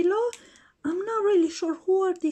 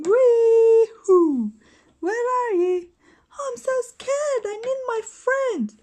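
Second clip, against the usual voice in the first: high, loud cry then a mock-scared whimper; 5 semitones higher, 6 dB louder than usual. Pink Ranger alone after the fall, high mock-scared voice. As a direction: toy heroine knocked down calling for friends.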